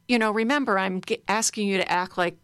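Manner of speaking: ordinary talk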